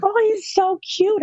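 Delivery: high, slow, swooning coo; gushing. Retold or imitated. Friends swooning over a cute boy, high gushing coo.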